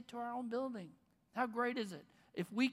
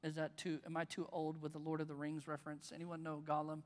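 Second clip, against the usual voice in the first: ordinary talk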